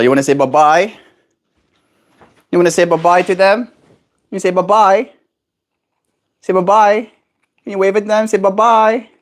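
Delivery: high, bright-eyed coaxing voice for a bird; high sing-song coaxing of a pet; pet baby talk. Acted. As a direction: coo at the parrot to come over.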